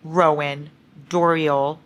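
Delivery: ordinary talk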